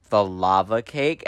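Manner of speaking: low, drawn-out questioning correction; slow, low retold query. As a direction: imitate a waiter correcting, slowly and low. Retold waiter correcting the order.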